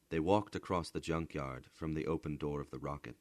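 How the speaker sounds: ordinary delivery, nothing marked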